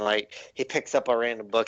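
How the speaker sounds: normal talking voice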